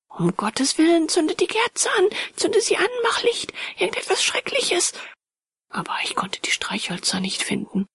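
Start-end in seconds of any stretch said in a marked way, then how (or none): 0.1-4.9 very high, frantic begging; high-pitched frightened cry for help; panicked pleading. Acted. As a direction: plead in terror, voice shooting right up. Terrified man begs for light.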